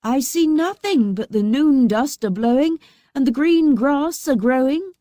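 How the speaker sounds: high sing-song answer; sister Anne, lightly voiced. A narrator's reading. Anne answering from the tower. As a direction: report from the tower, lilting.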